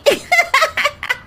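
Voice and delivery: bright playful giggle up high; high laugh. Acted. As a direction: laugh gleefully like a fairy. Hands over magic wand, laughs. (ऊँची खिलखिलाती हँसी)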